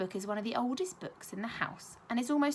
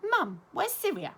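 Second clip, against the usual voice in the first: put-on child voice, higher and louder, eager; eager child's question; 7 semitones higher, 5 dB louder than usual. Child asking Mum, eager and higher. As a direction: blurt it out like an impatient little girl.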